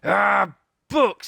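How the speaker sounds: high, loud disgusted pirate snarl; pirate captain scorning the books; pirate growl. A narrator's reading. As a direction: sneer at the word. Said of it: "said Captain Bones".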